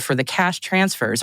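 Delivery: normal talking voice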